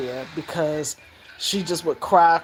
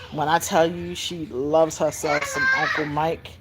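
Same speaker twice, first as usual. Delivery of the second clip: ordinary talk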